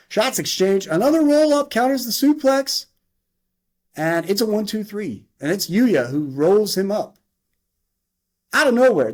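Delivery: high, excited match call; animated ringside commentary; wrestling play-by-play. Acted. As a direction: call the near falls with rising excitement.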